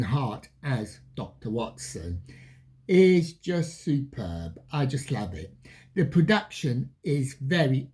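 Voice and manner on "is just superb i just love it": ordinary delivery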